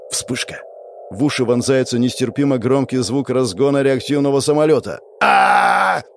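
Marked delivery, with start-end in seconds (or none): 5.2-6.0 loud, drawn-out "aah" of agony; sudden high shout of pain; scream of pain. Acted. Hero deafened, clutching his head, pained scream. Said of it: "Я упал на колени и схватился за голову".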